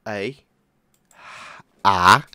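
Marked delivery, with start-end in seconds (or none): 1.8-2.3 sharp loud startled scream; a real yelp of fright; short scream. Real, not acted. Player caught by the monster, sharp scream. Sudden scare in game.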